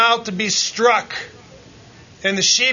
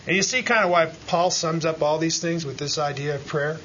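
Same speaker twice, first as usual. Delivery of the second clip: ordinary delivery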